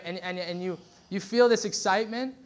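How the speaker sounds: ordinary talk, nothing marked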